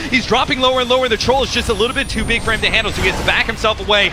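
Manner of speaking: high, loud, rushing fight call; excited play-by-play. Acted. Caster yelling the fight to the crowd, high and loud. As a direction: shout the teamfight as it explodes. Casting a teamfight.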